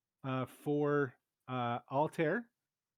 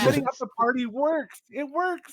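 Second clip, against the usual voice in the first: excited; 10 semitones higher, 9 dB louder than usual. Real, not acted. GM cheers surprising success.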